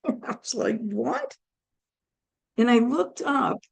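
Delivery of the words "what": retold surprise. Reacts to the cells' astonishing news.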